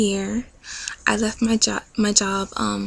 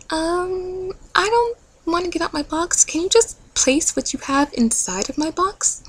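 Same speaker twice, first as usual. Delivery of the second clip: put-on much higher, childlike pleading voice; reluctant whiny self; 9 semitones higher than usual. Her reluctant self begging God to bring the gift, whiny high voice. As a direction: wheedle like a kid who won't leave.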